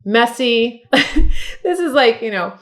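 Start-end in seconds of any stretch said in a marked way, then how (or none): 0.9-1.6 a short breathy burst of laughter; bright laugh. Real, not acted. Beauty vlogger laughing at her messy eyeshadow, a short bright laugh. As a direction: laugh at your own messy eye.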